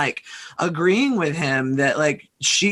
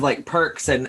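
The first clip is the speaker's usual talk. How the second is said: ordinary delivery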